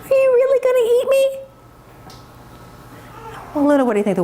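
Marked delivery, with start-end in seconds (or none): 0.0-1.2 very high, quick calf voice; tiny high voice in disbelief; little calf. Acted. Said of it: "And Bella says".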